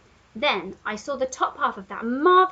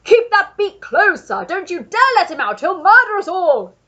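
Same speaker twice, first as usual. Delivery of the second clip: loud, higher panicked bellow; Duke bellowing; 8 semitones higher, 12 dB louder than usual. A narrator's reading. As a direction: roar it at the pelican. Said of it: "he bellowed".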